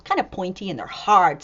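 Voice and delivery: ordinary delivery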